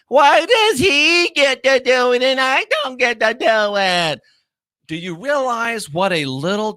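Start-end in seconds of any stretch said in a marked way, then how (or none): 0.1-4.2 high, whining mimicry of a jealous kid; whiny mock child. Mocking Zachary's complaint as childish whining, high whine. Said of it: "cry and whine and say".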